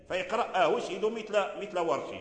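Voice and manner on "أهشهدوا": "أهشهدوا" with a short first a straight into "hu"; no inserted alif. Shown as a demonstration. Teacher showing the other reading of "أهشهدوا", no long alif. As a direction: keep the opening of "أهشهدوا" short. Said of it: "لا يدخل هذه الألف".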